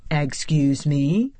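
slow, mildly taken-aback question; surprised. A narrator's reading. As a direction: draw it out in surprise. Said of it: "the man asked with surprise".

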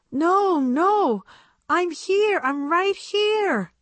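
high, hurried-to-reassure aunt's call. Acted. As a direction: call out high that you've been found. Missing aunt calls out to others.

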